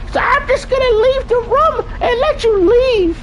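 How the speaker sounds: very high squeaky mocking puppet voice; mock him in a shrill cartoon falsetto; Elmo. Streamer mocking the game's zesty dude, Elmo voice. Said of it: "like Elmo".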